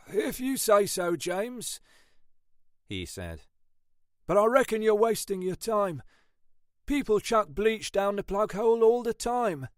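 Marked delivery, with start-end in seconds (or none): 0.1-1.8 higher, doubtful constable voice; sceptical colleague. Acted. Ashford doubts James's drain theory, sceptical colleague voice. 4.3-9.7 higher, doubtful constable voice; sceptical colleague. Acted. Ashford doubts James's drain theory, sceptical colleague voice.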